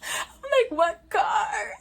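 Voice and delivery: high, laughing through the words; laughing at her own cluelessness. Real, not acted. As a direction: crack up while repeating it. Laughing while retelling.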